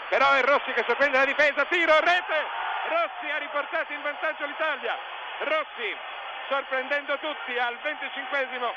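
loud, high shout of tiro, rete; breathless italian goal commentary. Real, not acted. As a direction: erupt into the goal call. Commentator calls second goal.

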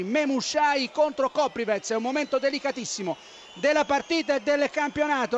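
ordinary talk

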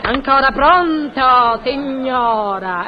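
slow, stretched-out phone greeting; exasperated phone answer. Acted. Portiera answering a pestering caller, slow and exasperated. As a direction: drag out every word with strained patience.

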